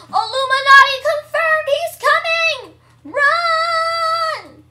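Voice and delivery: very high, loud alarm; hammed-up high-pitched panic; panicked screaming. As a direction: scream a drawn-out warning. Warning players as disaster appears.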